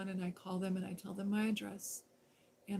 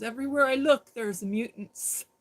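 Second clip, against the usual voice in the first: quoting him; 4 semitones higher, 10 dB louder than usual. Retold or imitated. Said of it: "He goes".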